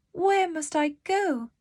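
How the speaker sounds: Heidi's small worried question; little girl asking. A narrator's reading. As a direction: ask it as young Heidi. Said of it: "said Heidi".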